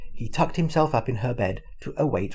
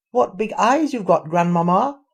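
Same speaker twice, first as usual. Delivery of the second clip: put-on high, loud, uneasy girl; little girl starting to worry; alarmed little girl; 7 semitones higher, 7 dB louder than usual. A narrator's reading.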